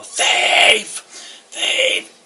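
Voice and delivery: hoarse shouted cry for help; yell. Acted. Game master voicing townsfolk chasing a thief, yelling. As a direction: shout it out as an alarm across a crowd. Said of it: "two people behind you yell".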